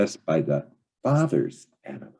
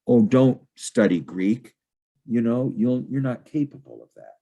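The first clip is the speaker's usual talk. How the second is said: higher-pitched mock father voice; a hint of a patronizing father brushing her off; dismissive father; 6 semitones higher than usual. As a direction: lightly play the condescending dad. Father discouraging daughter's studies.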